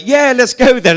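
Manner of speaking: mock the group jumping at the chance; eager group cheer. Friends cheering that the boat can go after all, eager. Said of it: "everyone was like".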